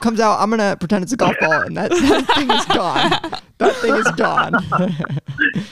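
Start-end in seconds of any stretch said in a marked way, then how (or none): 1.6-5.6 laughing. Pretend. Jokes about hiding the wallet.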